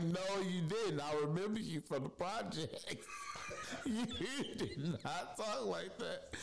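high, amused mock protest; challenging friend. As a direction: imitate a friend calling her bluff. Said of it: "he was like".

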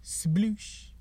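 mouthed splash noise, high and soft; splash sound effect. Shown as a demonstration. Host demoing a dad's sound effect, a soft splash noise. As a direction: make the sound of a goat hitting water. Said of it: "That's the sound of a goat falling in water".

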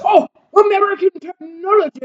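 high, loud mock marvelling; Sergeant Anderson. Sergeant teases excited British soldier from back of class, mock awe. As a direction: imitate a classmate crying out in mock wonder. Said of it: "another guy in the back of the class".

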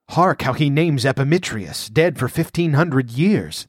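usual speaking voice

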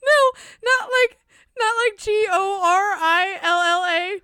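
spelling through helpless high-pitched laughter; can't stop laughing. Real, not acted. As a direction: try to explain while cracking up. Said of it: "I'm sorry, I can't stop laughing".

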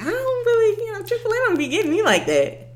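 mocking friend. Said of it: "You just like".

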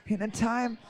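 hushed movie-trailer narrator; creepy pilot doing a film trailer. Acted. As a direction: intone it softly, building to the hero's name. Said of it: "I want that voice to say it".

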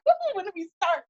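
high excited woo; eager cheer. Retold or imitated. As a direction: cheer like a keen recruit. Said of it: "kinda like".

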